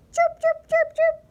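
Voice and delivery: very high, loud chirping; four short piercing bird cheeps; bird chirps. A narrator's reading. Narrator voicing a bird suitor, high chirps. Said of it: "There was bird".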